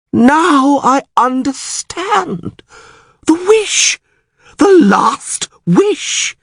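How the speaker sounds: very high, drawn-out exclamations; sudden realisation. A narrator's reading. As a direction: cry it out slowly as the revelation hits. Priest suddenly grasps the truth.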